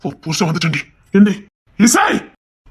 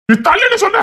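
higher, yelling in rage; furious brother shouting at the man blocking the door; angry shout; 12 semitones higher than usual. Acted. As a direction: bark it at the man blocking you.

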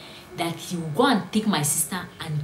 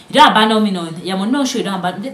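mock angry shouting; 3 semitones higher, 12 dB louder, faster than usual. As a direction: shout it like a jilted caller. Angry caller shouting on phone.

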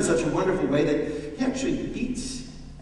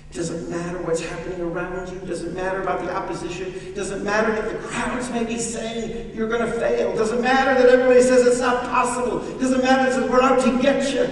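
starts even, climbs to a loud, higher preach; rising pulpit fervour; building preaching; 7 semitones higher, 6 dB louder than usual. Acted. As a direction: build the refrain to a shout. Builds sermon refrain.

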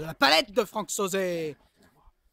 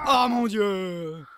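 drawn out; much slower than usual. Real, not acted. Drawn-out commentary reaction.

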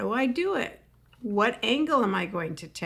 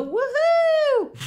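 much higher, louder whooping cheer; woohoo cheer; 7 dB louder than usual. Shown as a demonstration. Comic showing audiences how to cheer a flaw, loud whoop. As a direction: celebrate it like a party. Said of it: "And then go".

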